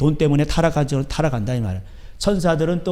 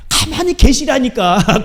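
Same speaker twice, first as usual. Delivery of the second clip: higher, louder, slow nagging; mock-exasperated rebuke; exasperated scolding; 8 semitones higher, 6 dB louder, slower than usual. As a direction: drag it out in exasperation.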